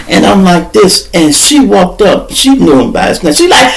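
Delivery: ordinary talk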